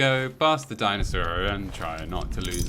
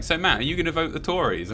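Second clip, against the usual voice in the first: imitating gran; 8 semitones higher than usual. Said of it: "she was like".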